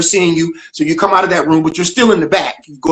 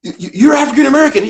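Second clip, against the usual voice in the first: doctor voice; 6 semitones higher than usual. Retold or imitated. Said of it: "He goes".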